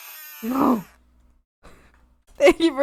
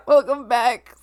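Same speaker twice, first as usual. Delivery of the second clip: ordinary talk, nothing marked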